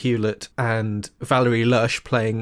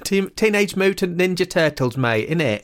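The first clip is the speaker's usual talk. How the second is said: higher-pitched Lincolnshire mimicry with a laugh; Lincolnshire accent; 5 semitones higher than usual. Shown as a demonstration. Podcaster echoing the co-host's turtles line, Lincolnshire accent. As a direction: say it back in matey Lincolnshire. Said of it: "my way into a Lincolnshire accent".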